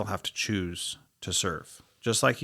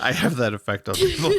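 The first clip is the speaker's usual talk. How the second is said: laughing; 9 semitones higher, 8 dB louder than usual. Real, not acted. Joking, laughing.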